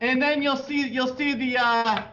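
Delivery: high, sing-song Wisconsin local voice; Wisconsin accent. Retold or imitated. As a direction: play a chirpy Wisconsin local giving directions. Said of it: "They go".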